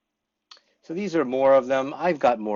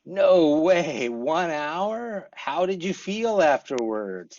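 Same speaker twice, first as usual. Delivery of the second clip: higher, amazed no way then a question; surprised question; 5 semitones higher, much slower than usual. Shown as a demonstration. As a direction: sound amazed, then ask more. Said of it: "Like this".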